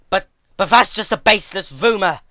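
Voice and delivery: high, loud stammering retort; shot back. A narrator's reading. Twilight arguing back at Celestia. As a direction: snap it back, flustered. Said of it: "she shot back".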